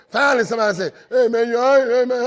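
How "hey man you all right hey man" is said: higher, louder voice of a passerby; concerned stranger. Retold or imitated. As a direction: call out to the hurt man. Stranger checks on injured man.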